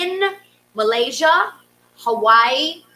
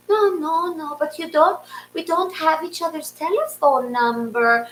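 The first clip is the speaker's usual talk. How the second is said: lightly voiced older neighbor, higher; a hint of the fussing lady next door; higher quoting of the worried neighbor; 5 semitones higher than usual. Neighbor Gabriel quoted again.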